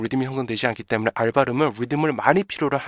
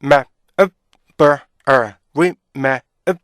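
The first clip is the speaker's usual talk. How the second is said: "rip, map, 음, 버, 어" as detached syllables; loud staccato drill of remember's pieces; beat-by-beat breakdown; 6 dB louder, much slower than usual. Shown as a demonstration. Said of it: "이게 있잖아요".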